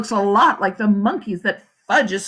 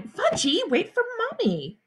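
mother hollering; 12 semitones higher, 4 dB quieter, slower than usual. A narrator's reading. Mother running after Fudge, high hollered call. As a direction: call after a runaway child, pitched way up.

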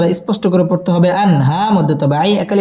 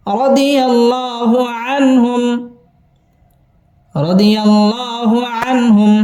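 slow, lightly chanted phrase; modelled recitation; 5 semitones higher, slower than usual. Shown as a demonstration. Teacher showing students the joined words, slow chant. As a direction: stretch it out as a model for learners. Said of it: "teacher models the joined phrase".

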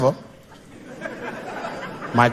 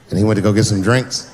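ordinary talk

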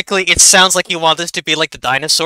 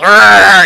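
dinosaur roar; 3 semitones higher, 9 dB louder than usual. Acted. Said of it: "when he goes".

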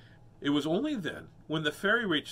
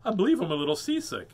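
louder, higher lady's voice; raised, theatrical storybook lady; lady character; 6 semitones higher, 7 dB louder than usual. Acted. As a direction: play a fussy lady remarking on the seas. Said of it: "said the lady".

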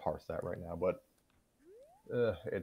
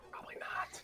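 a quick breathy whisper tacked on; whispered aside; 8 dB quieter than usual. Real, not acted. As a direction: drop to a whisper for the joke. Joking aside after hoping next week.

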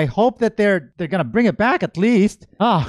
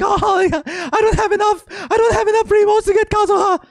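high, whiny hammed-up wail; mock panicked employee; 11 semitones higher, faster than usual. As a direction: play a frantic number-cruncher close to tears. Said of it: "somebody's there with a calculator and he's like".

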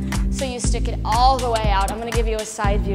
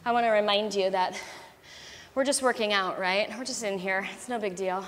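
quiet reassurance; 2 semitones lower, 9 dB quieter than usual. Real, not acted. Quiet reassuring pep talk.

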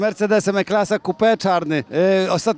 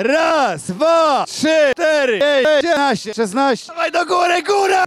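excited cheering count; 6 semitones higher, slower than usual. Real, not acted. Host cheering push-up count.

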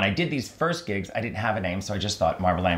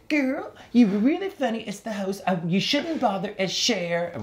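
Lady Bunny impression; 11 semitones higher than usual. Lady Bunny advising the young host, high put-on voice. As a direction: deliver blunt advice as the older queen. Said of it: "you know her voice".

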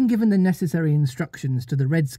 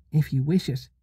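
cool and indifferent. Acted. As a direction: say it like it means nothing. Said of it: "as coolly as if she had asked".

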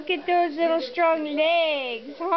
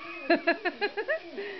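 delighted laugh; 4 semitones higher, 7 dB quieter than usual. Real, not acted. Laughing after the cheer.

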